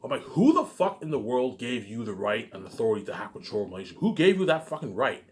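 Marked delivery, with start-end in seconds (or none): none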